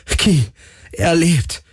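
high-pitched, elated cry; overjoyed exclamation; jubilant. A narrator's reading. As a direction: cheer it out in joy. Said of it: "jubelte Galen".

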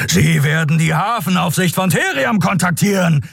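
high-pitched enraged shout; furious, sustained bellowing; roaring. A narrator's reading. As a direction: roar it out in fury. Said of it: "brüllte Keru".

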